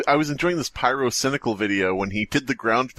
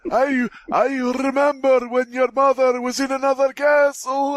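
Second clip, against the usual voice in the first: put-on old-timer voice; 11 semitones higher than usual. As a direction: ham up a doddering old man.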